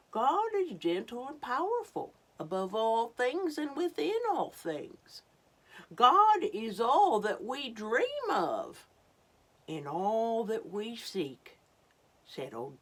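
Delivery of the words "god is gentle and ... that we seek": put-on grand Old Turtle.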